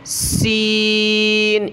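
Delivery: سین drawn out slowly with a thin whistling hiss; whistled thin seen. Shown as a demonstration. Teacher showing the whistling sound of سین. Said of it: "سیٹی کی آواز نکال کر پتلا کر کے پڑھتے ہیں".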